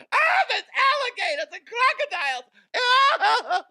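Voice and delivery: high, loud mock panic shout; shrieking in mock alarm at crocodiles. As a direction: yelp it like you're fleeing a gator.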